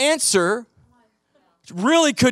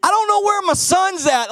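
much higher, anxious insisting; worried protest; 9 semitones higher than usual. Retold or imitated. Husband to calm wife, alarmed insistence. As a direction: insist she doesn't get it, alarmed. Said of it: "And I'm like".

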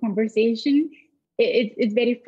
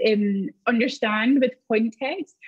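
ordinary talk, nothing marked

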